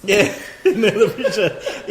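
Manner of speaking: laughing, loud and high. Real, not acted. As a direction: speak through a laugh, slowly. Laughing at his own joke.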